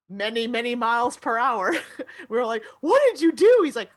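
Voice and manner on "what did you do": loud, high eager question; excited question. Retold or imitated. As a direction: blurt it out, thrilled. Students asking teacher, excited.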